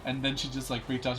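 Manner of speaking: usual speaking voice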